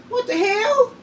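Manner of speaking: shocked. Real, not acted. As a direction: blurt it out in disbelief. Shocked exclamation at four meals.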